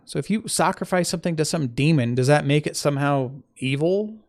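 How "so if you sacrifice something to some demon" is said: ordinary talk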